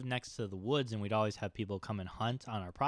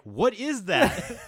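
loud, very slow, high what; surprised doctor; 8 semitones higher, 7 dB louder, much slower than usual. Retold or imitated. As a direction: drag it out in shock. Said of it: "they're like".

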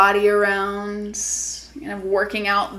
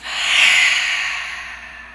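dramatic sigh. Shown as a demonstration. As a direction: let out a huge grounding sigh. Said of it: "Ground your energy with a big sigh out of your mouth".

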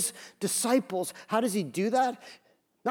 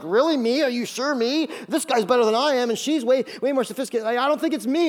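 high, loud, incredulous protest; can't believe he means you, protest it higher and louder; disbelieving surprise; 5 semitones higher, 5 dB louder than usual. Retold or imitated. Preacher retelling his call, loud incredulous protest. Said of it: "And I was like".

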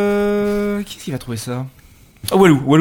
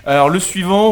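ordinary delivery

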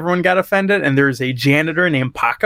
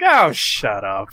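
lower, slow, fed-up brush-off; mock dismissive groan; 4 semitones lower, slower than usual. Reviewer scoffing at the zombie strippers line, slow fed-up brush-off. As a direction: roll your eyes at the corny line. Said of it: "I'm like".

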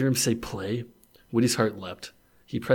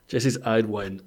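usual speaking voice

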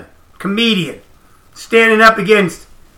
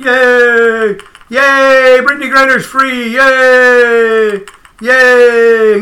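sarcastic yay, a bit louder; mock cheering; 4 semitones higher, 3 dB louder, much slower than usual. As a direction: fake celebration, dripping with irony.

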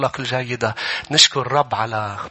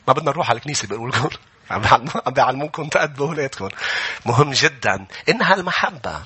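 normal talking voice

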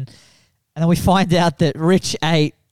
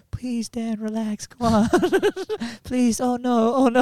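pleading burger shop owner; 7 semitones higher, slower than usual. Retold or imitated. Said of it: "the Burger Master goes".

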